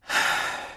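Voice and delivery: soft breathy weary sigh. A narrator's reading. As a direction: let out a tired sigh. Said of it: "Jonas sighed".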